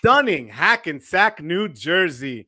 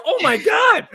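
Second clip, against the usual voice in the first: high, loud shocked exclamation; retelling meeting an old friend, shocked shout; surprised shout; 14 semitones higher, 4 dB louder than usual. Retold or imitated. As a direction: blurt it out like you just recognized an old friend. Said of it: "I said".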